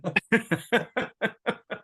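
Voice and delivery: a real rising laugh. Real, not acted. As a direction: laugh at the punchline. Laughs after punchline.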